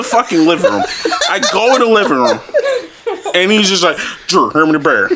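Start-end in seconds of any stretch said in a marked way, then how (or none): 4.3-5.1 mumbled mock-dad request, barely decipherable; dad's mangled speech. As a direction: imitate dad's garbled, slurred request. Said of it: "he just couldn't say it".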